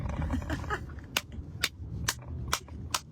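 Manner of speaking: soft airy laughter; breathy laugh. Real, not acted. Preacher laughing at her own good point, airy laugh. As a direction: laugh quietly in delight.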